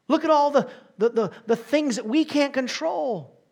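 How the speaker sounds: loud, high exclamation; loud preaching cry. Acted. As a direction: cry it out in wonder. Preacher contrasting man and God.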